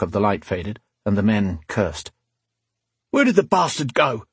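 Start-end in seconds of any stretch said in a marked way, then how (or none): 3.1-4.2 higher-pitched, rough-edged thug's demand; baffled thug. A narrator's reading. One attacker asks the other in the dark, baffled thug. Acted quote of a cursing attacker.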